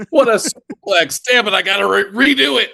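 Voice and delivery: mock play-by-play breaking off annoyed; mock commentator. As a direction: ham up the call, then snap at yourself. Mocking friend's commentary retakes.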